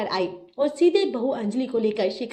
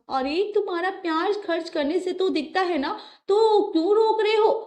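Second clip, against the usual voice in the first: pouting wife; 8 semitones higher than usual. Acted. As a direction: wheedle him into buying it. Wife coaxes husband to spend.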